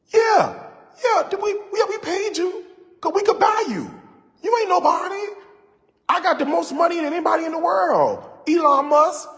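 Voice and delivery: high, loud mocking boast; mock rich boasting. Rich payer lording it over a hired performer, high mocking boast. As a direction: crow it high and loud like a big spender.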